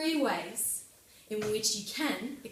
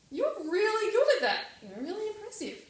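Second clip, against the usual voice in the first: impressed; 11 semitones higher, 5 dB louder than usual. Retold or imitated. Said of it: "I was like".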